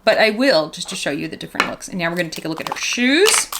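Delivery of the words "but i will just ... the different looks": ordinary talk, nothing marked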